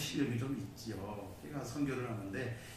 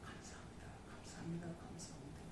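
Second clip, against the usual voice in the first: very soft, repeated murmur of thanks; barely voiced grateful prayer; hushed thanksgiving; 17 dB quieter than usual. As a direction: breathe out thanks to God under your breath. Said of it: "하나님의 소리를 듣고 감사".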